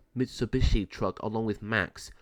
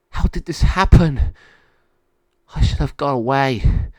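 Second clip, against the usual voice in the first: high, loud lament; raised voice of an upset dog; distressed dog; 4 semitones higher, 10 dB louder than usual. Acted. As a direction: cry out in dismay as a trapped dog. Monty despairs in the pound.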